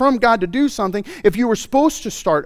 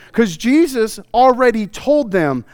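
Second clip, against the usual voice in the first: ordinary talk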